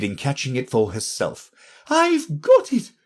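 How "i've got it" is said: high, slow triumphant cry. A narrator's reading. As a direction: crow over catching the shawl. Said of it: "in a triumphant tone".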